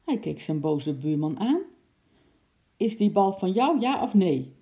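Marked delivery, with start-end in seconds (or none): 2.8-4.5 demanding shout. A narrator's reading. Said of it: "riep meneer Prat".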